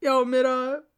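hammed-up dying voice, high; wild overacting. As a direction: overplay a fake collapse. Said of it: "наигранным голосом сказала подруга".

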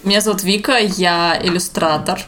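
usual speaking voice

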